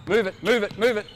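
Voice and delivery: loud repeated urging; urging shout. Real, not acted. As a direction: push them to move, loud. Coach urging players to move.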